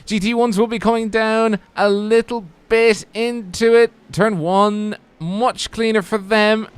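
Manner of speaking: high, driving race commentary; excited play-by-play. Real, not acted. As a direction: call the race start as it unfolds. Live play-by-play of race start.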